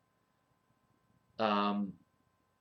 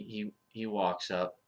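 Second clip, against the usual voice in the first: ordinary talk, nothing marked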